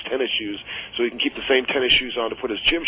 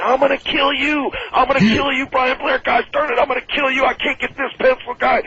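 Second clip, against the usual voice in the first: screaming threat; 12 semitones higher than usual. Retold or imitated. Paul screaming at Brian after the pencil prank, frantic yell. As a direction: shriek it like a man in pain and fury.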